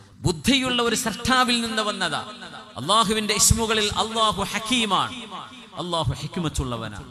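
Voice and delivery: fiery sermon peak; full-force preaching. Acted. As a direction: drive the point home from the pulpit. Preacher rises to proclaim the Creator.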